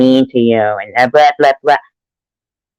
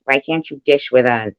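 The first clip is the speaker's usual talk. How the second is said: ordinary talk, nothing marked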